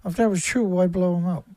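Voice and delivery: usual speaking voice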